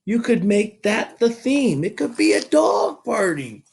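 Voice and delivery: put-on an eager small girl asking; little girl. A narrator's reading. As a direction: Sweetie Pie asking about the theme.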